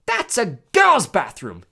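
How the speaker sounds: loud, high, slow disbelief; gasped shock. A narrator's reading. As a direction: exclaim in shock, stretching the words. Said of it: "he gasped".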